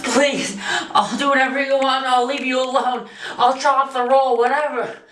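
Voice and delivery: frightened, high-pitched pleading; desperate pleading. Acted. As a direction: beg for your life. Begging the menacing director.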